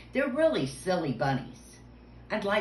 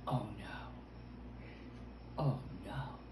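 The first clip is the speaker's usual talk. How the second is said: soft, low "oh no"; hushed pretend dismay; 8 semitones lower, 10 dB quieter than usual. Host peeking into a box, low hushed mock dismay. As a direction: murmur it low in mock worry.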